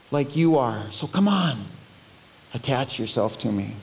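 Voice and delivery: subdued, earnest entreaty; pleading. Acted. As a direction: beg gently, dropping away at the end. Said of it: "Can't you just hear Jesus pleading".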